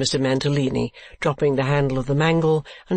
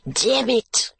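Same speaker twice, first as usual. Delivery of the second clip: high, strangled exclamation; suffocating cry; 9 semitones higher than usual. A narrator's reading. Mantalini hiding under the counterpane, suffocating cry. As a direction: cry out as if being smothered. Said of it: "he cried in a suffocating voice".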